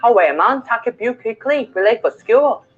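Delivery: Mackem with "take" as "tack" and "school" as "skyool"; Mackem accent. Shown as a demonstration. Teacher repeating the example for learners, in a Mackem accent. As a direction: give the Sunderland dialect version. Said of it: "with the Mackem accent".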